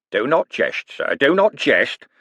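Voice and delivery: slow, mock-solemn old rogue. Con man feigning piety to Moist, slow and mock-solemn. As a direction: put on a pious gravity. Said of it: "said Cribbins solemnly".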